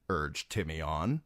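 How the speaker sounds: ordinary delivery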